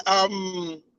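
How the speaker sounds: normal talking voice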